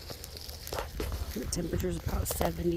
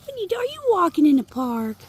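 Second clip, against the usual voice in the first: talking to a dog; 10 semitones higher, 7 dB louder than usual. Real, not acted. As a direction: coo up high at the pup. Cooing to the dog.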